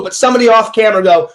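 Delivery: ordinary talk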